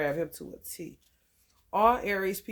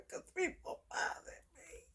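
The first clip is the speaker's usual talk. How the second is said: hushed, much higher whisper; 11 semitones higher, 13 dB quieter than usual. Real, not acted. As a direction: whisper it behind a laugh. Whispered aside.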